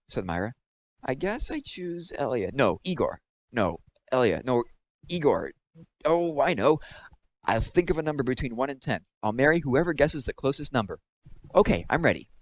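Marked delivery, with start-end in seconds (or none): 1.0-12.3 put-on dithering airhead girl. A narrator's reading. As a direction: play a silly girl who can't make up her mind. Narrator voices Myra dithering.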